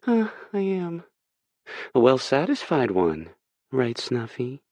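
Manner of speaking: high, smug and playful; Hurricane's mocking voice. Hurricane taunts Aspen from Kay's lap, high and smug. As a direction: toss it off like a taunt.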